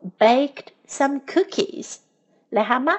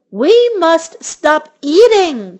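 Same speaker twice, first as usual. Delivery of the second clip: slow, loud, higher outcry; crying out; 5 semitones higher, 7 dB louder, slower than usual. A narrator's reading. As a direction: protest while still eating. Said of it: "cried Toad".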